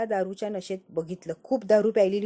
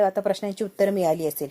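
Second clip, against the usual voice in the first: usual speaking voice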